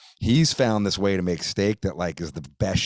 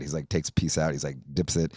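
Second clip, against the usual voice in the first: normal talking voice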